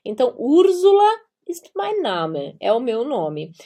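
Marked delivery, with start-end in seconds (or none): 0.4-2.6 Úrsula with a voiced z, higher; German pronunciation. Shown as a demonstration. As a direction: model the German sentence with "Urzula". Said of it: "em alemão". (Úrsula com som de Z, em alemão)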